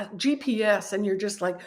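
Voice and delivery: ordinary talk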